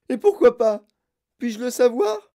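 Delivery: high, loud indignant demand; howling. A narrator's reading. Mother demands Wonka explain, howling. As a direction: yell the question at him. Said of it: "hurla Madame Gloop".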